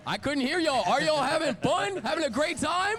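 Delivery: shouted, high and loud crowd hype; hyping the crowd. Real, not acted. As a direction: rile up the audience, arena style. Host hyping the live crowd.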